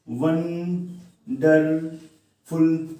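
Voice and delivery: Wonderful very slowly in three separate beats; wonderful stretched and broken into three pieces; split into syllables. Shown as a demonstration. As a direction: pause after each syllable of wonderful. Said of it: "इसमें three syllables हैं".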